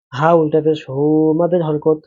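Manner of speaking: usual speaking voice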